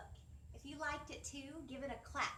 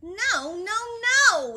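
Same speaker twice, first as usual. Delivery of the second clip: loud high frantic no; high shouted protest; shouting; 8 semitones higher, 20 dB louder, much slower than usual. Acted. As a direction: yell it like a fed-up dog owner. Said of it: "he shouts".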